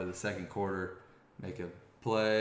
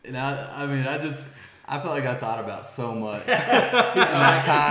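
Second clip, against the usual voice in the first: louder, higher, laughing through the words; laughing while telling; 5 semitones higher, 12 dB louder than usual. Real, not acted. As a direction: laugh out the memory.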